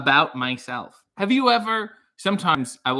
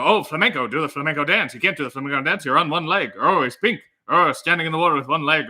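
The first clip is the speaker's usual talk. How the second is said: louder, fast, manic rambling excitement; zany improv voice bouncing from thought to thought; Robin Williams voice; 4 dB louder than usual. Acted. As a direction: ham up a giddy Robin Williams defending himself to his wife. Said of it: "Robin Williams' defense to his wife".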